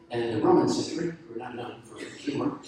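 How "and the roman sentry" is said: normal talking voice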